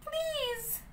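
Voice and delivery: high, soft pleading; begging. Acted. Pinocchio begging Geppetto for the bird, soft high plea. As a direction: beg like a small child. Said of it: "begged Pinocchio".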